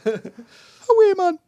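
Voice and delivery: very high, loud Geordie mouse. Acted. Co-host joining the mouse joke, high Geordie mouse voice. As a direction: squeak out mock Geordie through laughter. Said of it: "Geordie Mouse".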